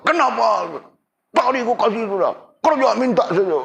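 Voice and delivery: mock an annoyed giver snapping back; angry refusal. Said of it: "jangan kau marah-marah dia". (marah, suara tinggi menyindir)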